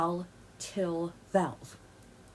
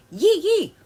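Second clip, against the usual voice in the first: mock yeehaw; 10 semitones higher, 8 dB louder than usual. Mocking beat-your-kids crowd.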